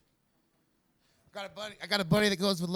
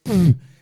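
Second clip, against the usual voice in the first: loud, short burst of blown air; mouth-blown spitting noise; 2 semitones higher, 17 dB louder than usual. Acted. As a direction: blow a sudden loud raspberry-like puff. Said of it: "as long as you don't go".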